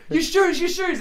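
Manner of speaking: put-on calling across the tracks to get the kid's attention; retold yell. To a stranger one platform away, retold yell.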